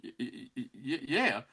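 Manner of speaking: quiet, stuttered mock reply; sheepish stammer. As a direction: stumble softly into a hesitant yes. Retold unsure answer to doubters.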